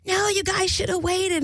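high-pitched mimicked complaint; imitating complaining woman. Mary scolds the couple who left her, high mimicking complaint.

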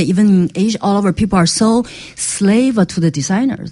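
usual speaking voice

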